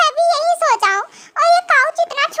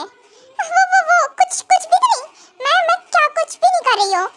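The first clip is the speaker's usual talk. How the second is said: higher, stammering nervous excuse; caught out; 3 semitones higher than usual. Acted. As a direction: stammer like someone caught red-handed. Wife caught searching, stammers. (पकड़े जाने पर हकलाती घबराहट)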